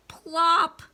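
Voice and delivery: high, loud pop; pop sound word. A narrator's reading. Reader bursting the balloon in the kids' story, loud and high.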